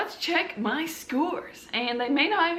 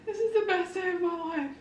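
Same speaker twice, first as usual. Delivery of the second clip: breathless joy; 6 semitones higher than usual. Real, not acted. Vlogger overwhelmed after passing, breathy high joy. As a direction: sigh out the happiness. Best day of her life.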